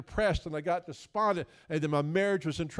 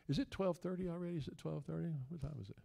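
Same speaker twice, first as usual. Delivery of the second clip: much softer off-hand question; hushed aside; 3 semitones higher, 10 dB quieter, faster than usual. Real, not acted. Preacher checking the time mid-sermon, hushed aside. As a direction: drop the volume to check the time.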